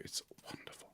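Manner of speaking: breathy whispered aside; a hushed whisper to the viewers. Real, not acted. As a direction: whisper it like a secret. Aside recommending a radio show.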